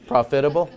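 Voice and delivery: "Profitable" as a questioning, quirky prof-itable; odd pronunciation. Shown as a demonstration. Preacher asking if they like it, "Profitable" oddly pronounced. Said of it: "You ever heard it pronounced that way before?".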